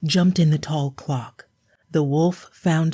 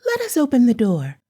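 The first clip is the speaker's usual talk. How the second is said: put-on high eager children's voice; small chicks promising to be good; little goslings; 7 semitones higher, faster than usual. Youngest gosling speaks.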